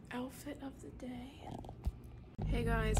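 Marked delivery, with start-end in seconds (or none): 0.1-1.5 hushed whisper, much softer. Real, not acted. Vlogger showing viewers a school-morning outfit, whispered. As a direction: whisper it, barely audible.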